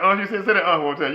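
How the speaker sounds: egging her on loudly; excited urging. Real, not acted. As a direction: come on, say it again. Asks wife to repeat the sound.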